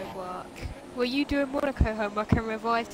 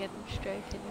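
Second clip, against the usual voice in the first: ordinary talk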